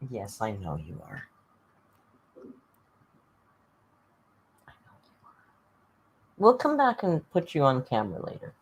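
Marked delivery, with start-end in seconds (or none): 0.1-1.2 soft cooing. Real, not acted. Cooing softly to the cat. 2.4-5.3 hushed cat talk; whisper. Real, not acted.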